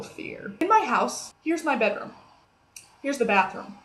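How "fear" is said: breathy whisper on the last word; whispered fear. Acted. Telling viewers about the scary movie, whispered. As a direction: let the word fade into a whisper. Dramatic word at sentence end.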